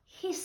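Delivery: short sibilant hiss; goose's hiss. A narrator's reading. Goose after the thieves are caught, short hiss. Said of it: "said the goose".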